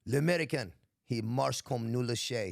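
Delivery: a French officer scolding in broken French-English; mock French accent. Colonel calls out the American's marching, light French accent. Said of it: "he's like".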